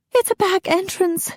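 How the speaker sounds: high, small answer; lightly voiced scared wife; frightened wife. A narrator's reading. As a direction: answer meekly.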